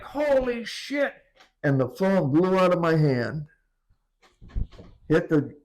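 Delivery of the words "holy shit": slow, high stunned exclamation; shocked. Retold or imitated. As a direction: blurt it out as the wave hits. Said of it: "It was like".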